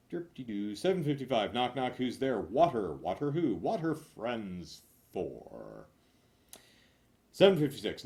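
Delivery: ordinary delivery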